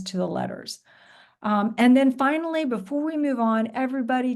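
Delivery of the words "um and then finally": ordinary delivery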